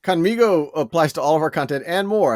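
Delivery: upbeat sales-pitch delivery; infomercial voice. Real, not acted. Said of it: "I sound like an infomercial".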